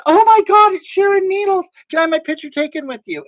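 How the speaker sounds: mock starstruck fan squeal. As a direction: squeal it like an excited fan meeting a star.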